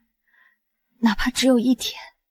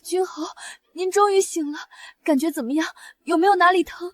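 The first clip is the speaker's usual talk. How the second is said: higher, soft, anxious questions; tearful concern; 7 semitones higher, 4 dB quieter than usual. Acted. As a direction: ask gently, close to tears. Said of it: "你俩哭什么？". (带着哭意轻声关切)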